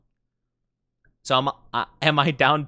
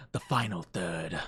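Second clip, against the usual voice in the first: hushed dramatic whisper; building suspense in a hushed whisper; 8 semitones lower, 9 dB quieter than usual. Pretend. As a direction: whisper it like a secret. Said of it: "whispered mystery reveal".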